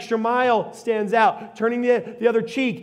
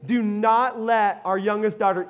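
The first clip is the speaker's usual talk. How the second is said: ordinary talk, nothing marked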